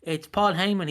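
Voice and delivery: ordinary talk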